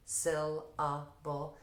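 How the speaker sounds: "syllable" slowly divided into syl, a, ble. Shown as a demonstration. Teacher showing where she splits it, "syllable" slow in three separate parts. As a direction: break "syllable" into three drawn-out beats. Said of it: "they syllabicate syllable".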